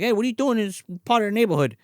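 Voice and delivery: quick high friendly question; high quick boy voice. Retold or imitated. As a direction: toss it off like a curious kid. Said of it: "Jeff's like".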